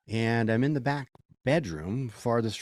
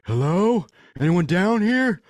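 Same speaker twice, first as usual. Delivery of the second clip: calling out; 8 semitones higher, 9 dB louder, slower than usual. Retold or imitated. Calling into basement.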